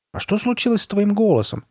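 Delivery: put-on high, curious boy's question; high-pitched wondering; little-boy voice. A narrator's reading. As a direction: ask it like a puzzled little brother. Little brother asks, wondering.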